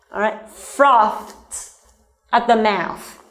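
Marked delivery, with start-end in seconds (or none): 0.5-1.3 "Frothed" with voiceless th, then a crisp t; ending first, then T. Shown as a demonstration. Teacher adding T to froth, carefully pronounced. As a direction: finish "Frothed" on th followed by t. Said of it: "pronounce ending sound first and then add T".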